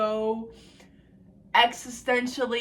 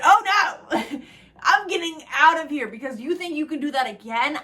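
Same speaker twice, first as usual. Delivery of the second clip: louder and higher, laughing through it; laughing retort; 5 semitones higher, 8 dB louder than usual. Retold or imitated. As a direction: tell him off while cracking up. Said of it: "I said".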